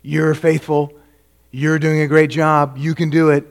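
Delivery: hammed-up cheer for the Corinthians; mock cheerleader. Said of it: "Paul doesn't say".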